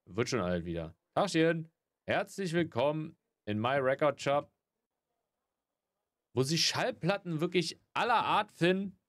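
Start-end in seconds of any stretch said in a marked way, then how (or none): 1.2-9.0 shopkeeper greeting. Pretend.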